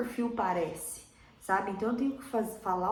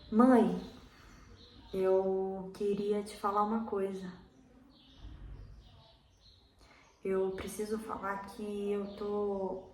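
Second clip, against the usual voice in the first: hesitant, halting lines with long silences; pausing for response; slower than usual. Shown as a demonstration. Actor demonstrating interpretation time to an imagined mother, hesitant, long pauses. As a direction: say it slowly, leaving room for an unheard answer. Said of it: "teve um tempo de resposta".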